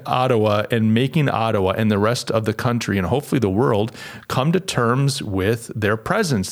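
ordinary talk

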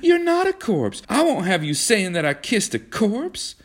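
very high, indignant outcry; horrified protest. A narrator's reading. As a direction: cry out appalled in a high voice. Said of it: "she protested in horror".

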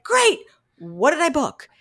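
mock-excited reply to good news; retold excitement. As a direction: re-enact thrilled, then puzzled reaction. Retells reacting to booking news.

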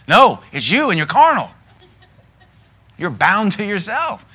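loud, high preaching rebuke; forceful rebuke. Real, not acted. Preacher rebuking the congregation, loud and high. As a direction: hammer the point home. Preacher rebuts the excuse loudly.

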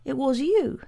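high small voice near tears of relief; high-pitched boy, relieved and tearful; relieved, tearful child. A narrator's reading. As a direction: let relief spill out as a little boy. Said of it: "began to cry easily with obvious relief".

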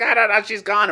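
dismissive woman. Retold or imitated. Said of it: "she's like".

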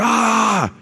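high, drawn-out wordless mock yell; imitated yell. Preacher imitating sushi chef yelling at waitress, high mock yell. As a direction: imitate an angry yell on one long high "ah". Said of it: "starts yelling at her".

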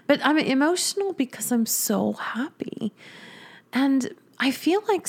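tearful half-laughing whisper; choked up with happy tears, barely voiced; getting emotional. Real, not acted. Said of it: "I keep crying all the time".

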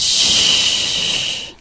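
soft unvoiced shh; a whispered hush; shushing whisper. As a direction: hush her gently, barely breath. God hushing her again.

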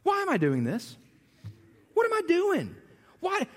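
exasperated self-questioning. Retold or imitated. Inner complaint while cleaning.

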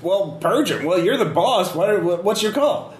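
annoyed sniper. Acted. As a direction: snap back impatiently. GM voices sniper.

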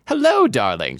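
bright chirp. A narrator's reading. Mother greeting her daughter, chirping brightly. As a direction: chirp it out lightly. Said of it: "she ch-, chirped bright".

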